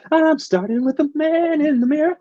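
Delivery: light [name] falsetto; [name] impression. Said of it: "pull little [name]".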